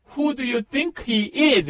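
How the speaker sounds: deliberate teaching pace; slow model sentence. Shown as a demonstration. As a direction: spell the question out word by word for learners. Demonstrating the target sentence.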